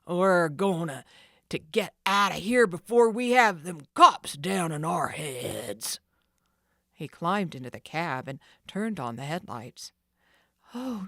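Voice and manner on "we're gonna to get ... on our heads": loud rough gangster voice; gruff thief. Acted. Sid urging the gang to flee, gruff. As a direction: bark the order to move. Thief leader barking orders.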